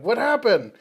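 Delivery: high, startled question; surprised. Acted. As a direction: ask in shock. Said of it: "Gary's like".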